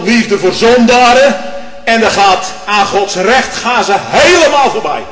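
fiery preacher climbing to a high, loud peak; preaching at full force. Acted. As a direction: build the sermon into a roar.

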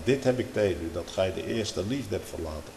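normal talking voice

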